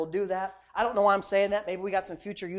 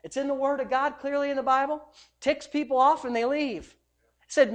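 normal talking voice

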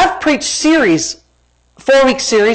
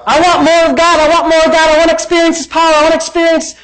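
higher, insistent mock plea; mock pleading; 6 semitones higher, faster than usual. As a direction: run the pleas together in a high, pleading chant. Mocking believers' plea before rebuttal.

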